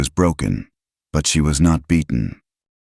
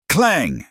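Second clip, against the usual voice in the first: hammer clang; 11 semitones higher than usual. A narrator's reading. Hammer sound in narration.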